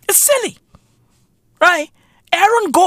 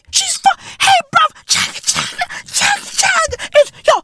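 high cracking rant. Real, not acted.

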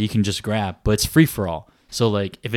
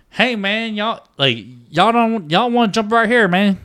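high, loud hillbilly twang imitation; put-on hillbilly drawl, pitched way up; hillbilly kid accent; 10 semitones higher, 4 dB louder, slower than usual. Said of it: "straight up hillbillies".

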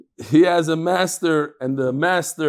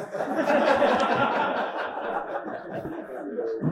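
laughter; 9 semitones higher, 6 dB quieter than usual. Real, not acted. Laughs at own joke.

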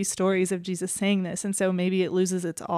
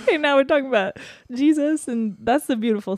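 laughing; 6 semitones higher, 5 dB louder than usual. Real, not acted. Laughing at topic drift.

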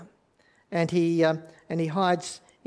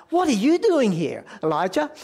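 higher and louder, a sharp accusing challenge; accusing demand; 4 semitones higher, 4 dB louder than usual. Shown as a demonstration. God confronting Elijah in the cave, sharp accusing demand. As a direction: fire the question at him like a rebuke. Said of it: "you could say it like".

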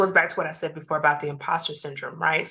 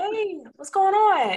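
much higher, slow, sing-song hello; cheery phone greeting; 13 semitones higher, slower than usual. Retold or imitated. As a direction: answer the doctor's call brightly.

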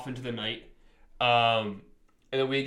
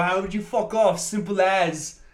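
put-on muddled British accent; 4 semitones higher, 4 dB louder than usual. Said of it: "It's going British".

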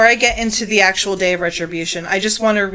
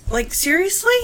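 incredulous; 10 semitones higher than usual. Real, not acted. Incredulous reaction to the story.

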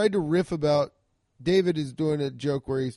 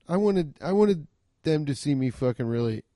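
usual speaking voice

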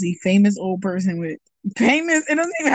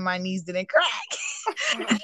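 laughing, slowing right down; amused disbelief; 6 dB quieter, much slower than usual. Real, not acted. Telling how the doctor's demonstration failed, laughing. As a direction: draw it out into a laugh. Laughing at her own story.

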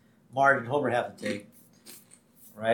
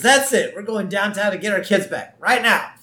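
higher, louder, determined outburst; mock angry Marge; 8 semitones higher, 6 dB louder than usual. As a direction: put your foot down like an angry mom. Marge furious, demanding kids back.

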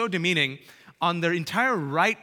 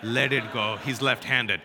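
uncle's voice; 7 semitones lower than usual. Retold or imitated. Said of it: "he's like".

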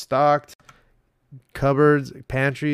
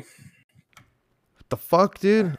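mock outrage; 7 semitones higher, 3 dB louder, much slower than usual. Real, not acted. Outraged reply.

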